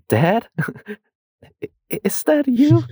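high, squeaky, slow little voice; mock lost son. John meeting his father, hammed-up timid high voice.

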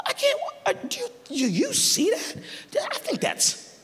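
very high, hushed voice; hesitant squeak as if peering into the distance; straining, unsure disciple. Acted. Disciples straining to see Jesus.

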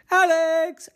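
high, sung-out call of a name. Acted. Kids looking for Alex, calling out high. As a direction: call for someone lost in the house.

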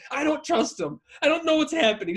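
high-pitched mock panic; acted-out alarm; imitated panicking mother.

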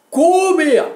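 high, loud mock growl of "Komm her!"; monster shout. Plant zombies shouting at intruding players, loud and high. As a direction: bark it like a lunging plant zombie. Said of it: "und sagen".

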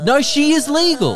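loud, high-pitched protest; emphatic insistence. Real, not acted. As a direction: insist loudly, pitch leaping up. Insisting on a disputed point.

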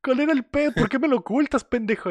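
reproche agudo de mamá, actuado; reclámale al hijo subiendo la voz de tono; mamá reclamando. Retold or imitated. (mamá exasperada, imitada en tono alto)

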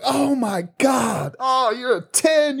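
high, drawn-out exclamation; hammed-up judge gasping at the reveal, slowly; mock astonishment. Judges exclaiming over the ugly face.

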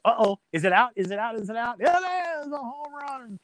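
high, rising home-run call, the last line slowed; imitating Harry Caray. Imitating Harry Caray calling a home run, high and building. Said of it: "you're at the edge of your seat".

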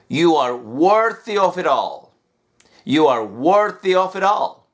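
words run together smoothly; linked words. Shown as a demonstration. Teacher links the words together as a demo. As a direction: link it all together. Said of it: "linkear las palabras para tratar de decirlo rápido".

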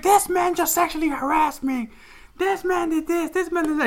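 high, indignant accusing voice; high-pitched outraged complaint; accusing woman. Retold or imitated. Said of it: "She was like".

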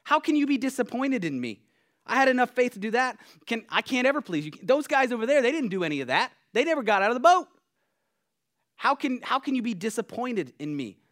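loud, high-pitched indignant outburst; bucking up in protest. Acted. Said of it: "I wanna buck up".

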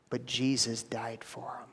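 soft unvoiced whisper of a defender's plea. Holy Spirit pleading to the court in a whisper.

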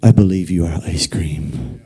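a hint of a hammed-up believer; mock prayer. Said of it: "We look at the ice cream and say".

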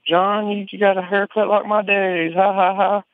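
put-on high, playful child's taunt ending in a giggle; teasing little girl. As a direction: mimic a little girl poking fun. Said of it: "kinda poking at him".

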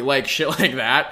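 usual speaking voice

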